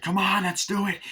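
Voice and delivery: high-pitched mock rallying cry; acted excited command; urgent urging. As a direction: urge the others on frantically. Acted urging during the ritual.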